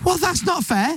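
high-pitched comic protest; mock indignation. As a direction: squeal that it's unjust. Mock protest about cats' tails.

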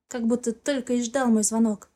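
ordinary delivery, nothing marked

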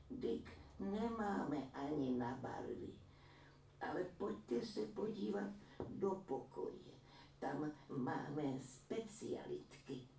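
a lightly voiced sly old woman, slowed down; a sly granny showing off her treasures. A narrator's reading. (lehce naznačená chytrá stařenka)

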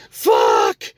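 one hard mock scream; imitated yelling. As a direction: imitate a man yelling out in outrage. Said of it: "He starts yelling".

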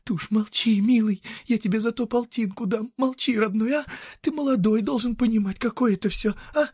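high, urgent woman's plea, fully voiced; fervent pleading. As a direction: beg for secrecy, pitched well up and pressing. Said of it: "горячо шепчет".

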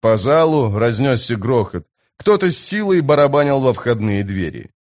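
usual speaking voice

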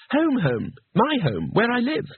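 high-pitched, sheepish explaining. A narrator's reading. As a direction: explain it in a small embarrassed high voice. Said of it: "Two Flower explained sheepishly".

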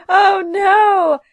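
loud high oh no; horrified wail; dismayed cry. Real, not acted. Reacting to box of hair.

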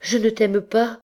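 high, pained exclamation; wounded, high-pitched protest; distressed cry. A narrator's reading. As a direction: cry it out in disbelief, pitch jumping up. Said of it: "s'écria la pauvre malheureuse enfant".

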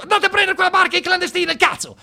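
very high, loud barked orders; Gheddafi shouting orders. Retold or imitated. Gheddafi phoning the port authority, yelling very high and loud. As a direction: bark orders at the coastguard like a furious dictator. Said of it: "lui al solito ha detto".

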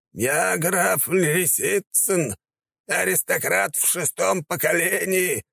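put-on slow, high trembling old voice; cling to dignity, voice shaking; tearful vows. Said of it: "дрожащим голосом произнёс".

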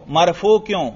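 ordinary talk

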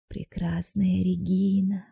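soft slow murmur; whispering. A narrator's reading. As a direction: murmur it slowly, dazed. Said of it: "шептал он".

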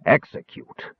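gruff, incredulous growl. A narrator's reading. Bearskin man answering the Kender, growling. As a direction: growl the word back. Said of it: "the bearskin man growled".

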